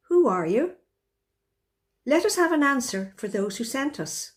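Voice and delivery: ordinary talk, nothing marked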